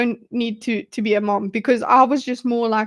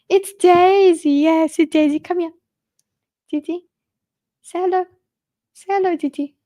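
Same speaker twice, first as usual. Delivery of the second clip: higher, sing-song pet talk; cooing at her cat; 5 semitones higher than usual. Real, not acted. Talking to her cat Daisy.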